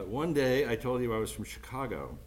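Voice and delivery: ordinary talk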